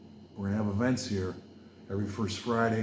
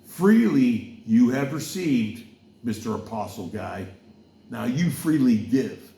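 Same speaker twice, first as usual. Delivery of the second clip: slow, louder preacher cadence quoting scripture; preaching; 5 dB louder than usual. Acted. Preacher quoting Jesus to the crowd, slow and emphatic. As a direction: deliver it like a sermon from the pulpit. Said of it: "I was gonna preach about".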